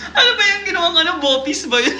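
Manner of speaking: quick, high question through laughter; real cracking up; laughing. Real, not acted. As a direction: giggle while asking. Reader laughing at a misread.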